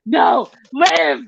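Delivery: high shouted command to live; mock shout. The Rock shouting at drowned woman, hammed-up shout. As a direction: yell at the body to live. Retelling The Rock's CPR shout.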